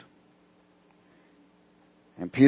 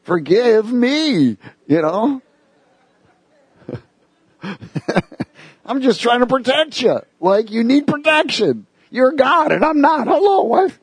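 high, pleading Peter, voice pushed up; 11 semitones higher than usual. Acted. Peter pleading with Jesus in a high excited voice. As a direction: play Peter protesting he only wants to protect.

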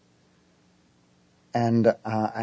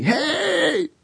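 high, drawn-out mock holler; retold yell; 14 semitones higher than usual. Dude in a truck yelling at me, retold holler. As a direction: imitate a stranger hollering from far off. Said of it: "the dude's yelling at me".

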